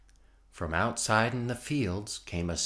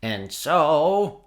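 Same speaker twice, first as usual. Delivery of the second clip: Lorax voice; 6 semitones higher, 9 dB louder than usual. A narrator's reading. Lorax voice resumes.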